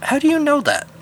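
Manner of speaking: high, sharp demand. A narrator's reading. Brian demanding Eddie explain how he knows, high and pressing. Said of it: "Brian demanded".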